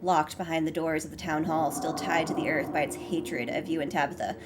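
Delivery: ominous storybook reading; story narration. Acted. Reading the haunted spirit passage, soft narrator voice. Reads game narration aloud.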